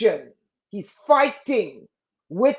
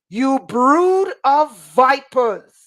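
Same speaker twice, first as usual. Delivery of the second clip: slow, loud, higher condemning cry; thundering rebuke; 5 semitones higher, 6 dB louder, slower than usual. Acted. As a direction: denounce them slowly, like a prophet. Preacher voicing Jesus' condemnation.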